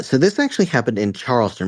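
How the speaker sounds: ordinary delivery, nothing marked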